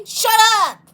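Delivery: high, loud snap; sudden furious acted outburst; angry shout. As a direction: yell it to silence someone. Angry reply to the prankster.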